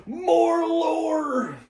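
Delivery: high, long-drawn mock celebration; gleeful cheer. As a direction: stretch it out high like a playful fanfare.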